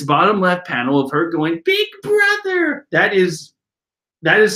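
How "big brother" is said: put-on very high, slow, crying-out little girl; little sister voice. Young Brulee calling to her big brother, high and small. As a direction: stretch it out like a tiny sister calling. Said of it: "when I use that voice".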